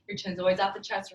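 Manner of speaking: usual speaking voice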